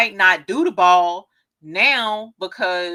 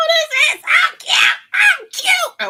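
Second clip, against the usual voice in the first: gleeful shout; 8 dB louder, slower than usual. Real, not acted. Shouts with glee.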